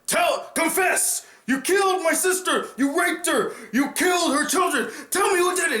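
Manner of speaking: a knight screaming at his downed foe for a confession; furious shouted demand. Retold or imitated. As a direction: yell the accusation at the fallen fighter. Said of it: "He's like".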